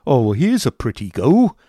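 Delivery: loud, put-upon schoolmaster; Squeers grumbling. Acted. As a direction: complain about the frozen pump. Squeers grumbles about pump.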